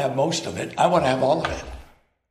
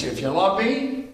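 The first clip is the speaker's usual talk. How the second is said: mock wrong verse; 8 semitones higher, slower than usual. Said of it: "No, no".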